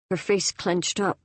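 ordinary delivery

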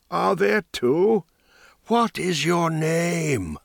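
a hint of a crone sizing him up; wondering old woman. Old woman questions mage.

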